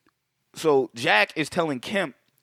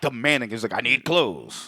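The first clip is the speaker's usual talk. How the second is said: slow menacing demand; 2 semitones lower, much slower than usual. Retold or imitated. Acting the villain's slow demand.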